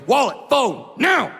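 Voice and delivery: mugger's shout. Retold or imitated. Mugger demanding the wallet, loud rising shout. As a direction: scare him into handing it over.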